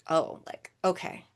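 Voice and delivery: ordinary talk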